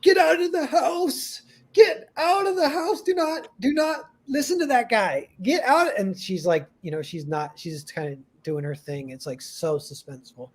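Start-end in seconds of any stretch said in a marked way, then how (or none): 0.0-6.0 high-pitched mock scream of warning; imitated screaming. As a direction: act out a frantic movie viewer yelling at the screen. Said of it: "So we're screaming, like".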